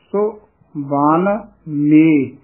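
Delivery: ਸੋ ਬਾਨ ਮੇ as three slow, parted words; words said separately. Shown as a demonstration. Teacher splits ਸੋ ਬਾਨ ਮੇ for reciters, separated words. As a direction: pause after ਸੋ and draw out ਬਾਨ ਮੇ. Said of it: "ਇਹ ਵੀ ਪਾਠ ਵੱਖਰਾ ਕਰਨਾ ਹੈ".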